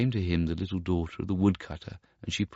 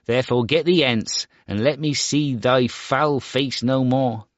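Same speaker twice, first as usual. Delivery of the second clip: higher, louder, scornful outburst; angry cry; 6 semitones higher, 5 dB louder than usual. Acted. Star Child drives off his mother, angry and loud. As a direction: cast her off in anger. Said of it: "cried the Star Child angrily".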